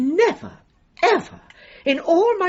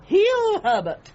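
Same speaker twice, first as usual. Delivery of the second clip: commanding a dog; 9 semitones higher, slower than usual. Real, not acted.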